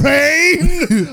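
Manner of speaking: high mock-dramatic exclamation; playful high outburst; mock dramatic cry. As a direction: cry it out like a hammy movie line. Acting out a film moment.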